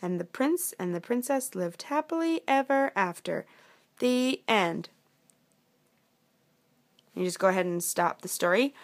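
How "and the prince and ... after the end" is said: lilting, raised-pitch narrator; storybook ending. Acted. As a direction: wrap up a fairy tale for children.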